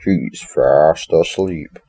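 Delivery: slow, drawn-out bear voice; Freddy. Retold or imitated. Toy bear reporting on the sleeping boy, slow Freddy voice. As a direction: draw each word out like a mascot. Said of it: "Freddy basically says".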